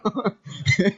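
short amused chuckle; brief burst of laughter; real laugh. Real, not acted. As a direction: chuckle after retelling it. Laughs retelling the request.

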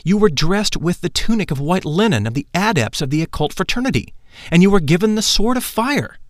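Lytolantes voiced. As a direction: tell it with awe. Lytolantes describes the rite.

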